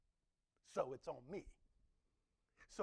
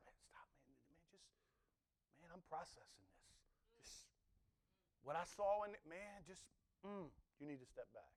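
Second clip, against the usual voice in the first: dazed hush; 9 dB quieter than usual. Acted. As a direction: speak as if still overwhelmed. Stunned priest still processing God.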